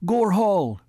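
high, eager calling of a name; excited call. A narrator's reading. Woman calling to the furry one, excited. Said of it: "The woman called excitedly".

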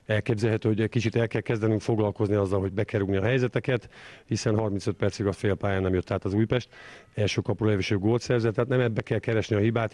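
ordinary talk

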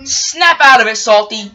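loud, sharp order to himself; self-scolding. Acted. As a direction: snap at yourself to buck up. Salty scolds himself out of nerves.

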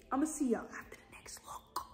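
hushed whispered sign-off. Real, not acted. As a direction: whisper it like a secret. Secretive sign-off to viewers.

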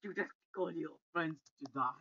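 ordinary talk, nothing marked

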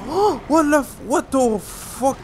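startled high yelp, then slow disbelief; jumped out of your skin; scared horror game streamer. Real, not acted.

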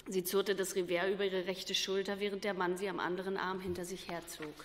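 plain reading voice

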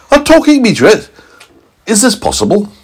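high, astonished questions; amazed scientist. A narrator's reading. Scientist marvels at meteorite, high astonishment. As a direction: squeal in disbelief. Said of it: "He said".

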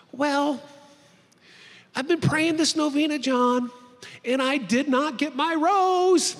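high, loud mock complaint; upset mother. Mom to son before surgery about her novena, aggrieved. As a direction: imitate a mom protesting, dragging out the last words.

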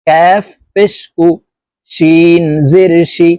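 slow, measured syllable-by-syllable recitation; deliberate teacher's model recitation; Quran spelling drill. Shown as a demonstration. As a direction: spell out each letter evenly, then chant the joined word.

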